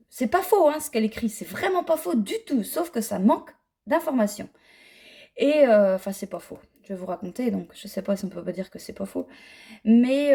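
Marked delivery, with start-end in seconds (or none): 0.1-2.6 high, stressed "pas faux"; emphatic. Real, not acted. Emphatic insistence. 6.0-9.3 soft, fading, losing the thread; trailing off. Real, not acted.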